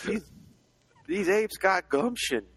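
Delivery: hammed-up cowboy prospector voice; old prospector. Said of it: "old-timey prospectors".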